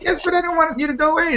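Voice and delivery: high, rapid retold protest; playful pleading. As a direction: plead excitedly with a friend not to leave. Acts out own pleading with friend.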